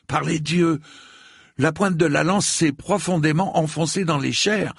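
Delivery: high alarmed exclamation; exclaiming. A narrator's reading. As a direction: cry out in alarm at the wound. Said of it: "entendit-il s'exclamer Raimoun".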